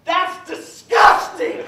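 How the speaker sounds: hammed-up appalled squawk; imitated child's disgust. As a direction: exclaim it slowly like an appalled little kid. Daughter's appalled reaction, retold.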